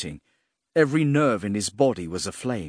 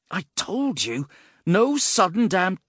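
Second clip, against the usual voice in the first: high, agitated scolding, cut short; exasperated warning; 9 semitones higher, 5 dB louder than usual. A narrator's reading. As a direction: protest sharply until cut off mid-sentence. Warning cut off as they vanish.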